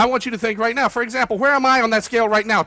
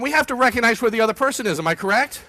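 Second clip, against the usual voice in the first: normal talking voice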